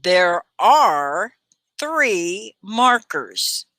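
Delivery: slow drill with three stressed; careful slow teacher model; slow model sentence. Shown as a demonstration. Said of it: "Can you say this?".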